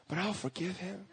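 tiny, soft mock-shy voice; timid guy. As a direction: barely dare to say it, imitating him. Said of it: "he was kinda, like, timid guy".

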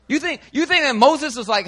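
usual speaking voice

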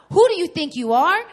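loud, high furious retort; angry yell. Retold or imitated. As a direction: throw it in his face, shouting. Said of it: "and I was so angry".

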